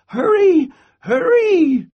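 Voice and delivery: high, loud grandmother's call; urgent calling. A narrator's reading. Grandmother waiting at the door, urgent high call. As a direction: call out urgently to bring them running. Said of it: "she called".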